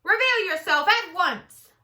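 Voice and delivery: loud, high demand; commanding shout. Acted. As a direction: call out sternly to the hiding one. Eugenia calling for missing Baby.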